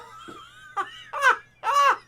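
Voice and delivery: a very high laugh breaking toward tears; shrieking laugh. Real, not acted. Streamer cracking up over the card pull.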